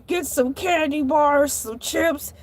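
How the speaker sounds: ordinary talk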